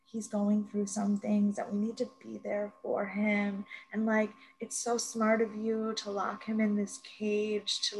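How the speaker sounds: soft, sugary fake sympathy; fake compassion. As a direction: coo it with fake concern. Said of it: "I wrote fake compassion".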